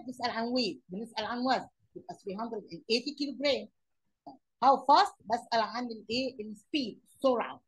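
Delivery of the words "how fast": emphatic question word. Shown as a demonstration. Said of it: "teacher announcing question word".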